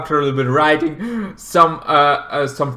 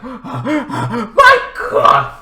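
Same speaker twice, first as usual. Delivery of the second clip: high gasped exclamation; mock shock; 9 semitones higher, 4 dB louder than usual. Siblings shaken after being run off road, mock shock. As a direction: blurt it out aghast.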